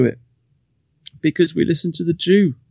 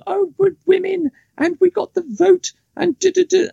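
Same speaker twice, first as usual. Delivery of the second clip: very high-pitched mocking falsetto; mocking women's voice; 4 dB louder than usual. Man mimicking women who talk about the vote, mocking. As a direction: mimic them in a silly, squeaky high voice.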